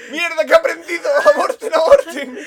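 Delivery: high, loud alarm breaking into laughter; mock panic. Scientist aborting the dolphin experiment, comic panic. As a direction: sound a hammed-up emergency.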